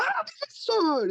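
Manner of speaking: mock startled shout from the class; startled students. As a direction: wake with a jolt, slow high mock cry. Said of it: "todo mundo desperta".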